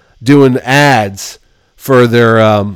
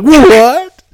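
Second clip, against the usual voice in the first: much higher, louder cry of disbelief; shocked what; 13 semitones higher, 6 dB louder than usual. Retold or imitated. Rejected by the cartoonist, loud outraged what. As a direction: yelp in outrage. Told I don't want you.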